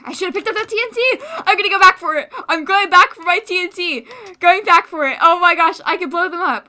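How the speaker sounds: high, loud and fast; excited. Real, not acted. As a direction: rush it out excitedly. Excited rush back for TNT.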